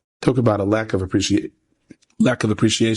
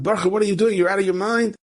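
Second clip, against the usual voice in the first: high-pitched mock yell, no real volume; retold yell; 9 semitones higher than usual. Coach driver to a man on the road, retold yell. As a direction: imitate a driver hollering in disbelief. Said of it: "the guy yells out".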